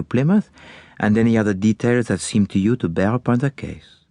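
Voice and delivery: put-on courteous, accented detective voice; formal Belgian-accented questioning. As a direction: accept the case as a polite foreign sleuth. Voices Poirot answering.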